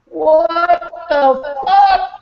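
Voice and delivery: high, slow, drawn-out disbelief; stunned what the fuck. Real, not acted. Turning on the light and seeing his messy room, stunned. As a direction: stretch it out in shock at the mess. Shocked at his own mess.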